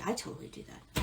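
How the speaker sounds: a soft confiding whisper; whispered aside. Real, not acted. Reader admits it to viewers, whispered. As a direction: drop to a secret whisper.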